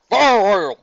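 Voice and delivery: loud bellow. Acted. Big Mac joining the crowd, loud bellow. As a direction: boom it out. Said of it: "Big Mac bellowed".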